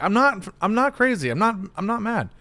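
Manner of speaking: high, loud mock protest; worked-up denial. Gaslit friend insisting on sanity, frantic mock denial. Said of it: "You're like".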